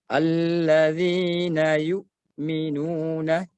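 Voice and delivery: "Alladzina yu'minuna" with a sharp stop on the hamzah, slow; hamzah held tight. Shown as a demonstration. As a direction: hold the hamzah fully closed. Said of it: "itu namanya huruf syiddah".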